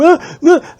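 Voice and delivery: high strangled gasps of a man being choked; choking gasps. Retold or imitated. Driver choked from behind, strangled high gasps. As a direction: gasp high and loud as if choked. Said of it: "this guy's struggling, going".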